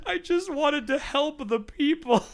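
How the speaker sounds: high, slow faux-innocent whine; a touch of hammed-up wounded innocence; mock innocence. Mock innocent, still laughing.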